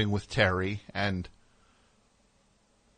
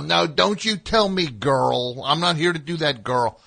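loud, higher mock shouting; mock yell; 6 semitones higher, 5 dB louder than usual. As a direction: shout it like a blustering sidekick. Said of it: "he starts to yell".

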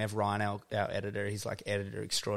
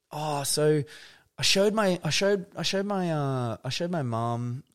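higher, louder client voice, slowing down; imitating client; 6 semitones higher, 4 dB louder than usual. Client relaying mum's feedback on the video, mock voice. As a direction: put on a fussy client voice. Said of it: "clients be like".

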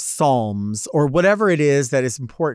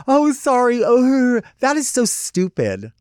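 high, loud mock-apology voice; mocking prankster; 8 semitones higher, 3 dB louder, slower than usual. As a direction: put on a loud, silly fake apology. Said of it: "going like this".

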